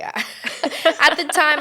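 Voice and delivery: usual speaking voice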